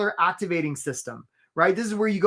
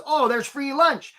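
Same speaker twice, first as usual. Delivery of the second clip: higher, slow delighted crab voice; a crab spotting free bait, lightly voiced; eager crab; 6 semitones higher, slower than usual. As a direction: Charlie the crab finds the bait, mildly delighted. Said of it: "Charlie the crab says".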